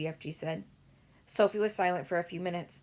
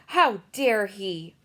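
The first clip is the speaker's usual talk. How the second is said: louder, slow outraged cry; how dare he, outraged; mock-indignant girl; 8 dB louder, much slower than usual. A narrator's reading. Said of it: "Sophie cried".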